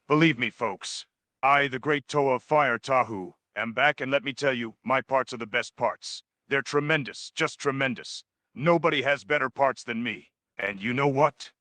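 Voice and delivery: normal talking voice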